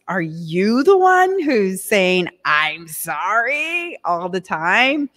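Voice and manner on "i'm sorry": slowly stretched imitation of an apology; drawn-out mock apology. Asking listeners who says it all the time, drawn-out mock apology. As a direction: draw out both words, imitating a habit.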